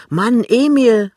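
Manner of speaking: loud, high, drawn-out call. A narrator's reading. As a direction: call out urgently to your husband. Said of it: "ruft die Frau". (laut nach dem Mann rufen)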